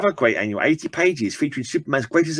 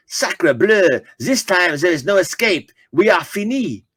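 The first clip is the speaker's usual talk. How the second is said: lightly hammed French accent, pitched a bit higher; doomed French pilot in a mild French accent; 6 semitones higher than usual. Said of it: "marks out of ten for my French accent".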